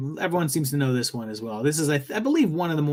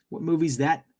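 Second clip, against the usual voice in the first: normal talking voice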